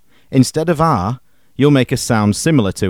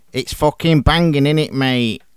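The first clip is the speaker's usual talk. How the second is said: put-on Manc accent; 3 semitones higher than usual. Back into the Manc voice.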